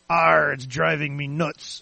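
slow, drawn-out pirate growl on the punchline. Acted. Pirate answers the bartender in a joke, pirate voice. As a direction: belt out the pirate punchline, stretched. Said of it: "And pirate goes".

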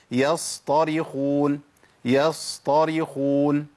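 "يصطرخون" very slow, holding the s far too long; over-long Sad. Shown as a demonstration. Teacher showing students a timing mistake, overlong Sad. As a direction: stretch the hissing ص in "يصطرخون". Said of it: "شفت الصاد عندي خد زمن قد إيه؟".

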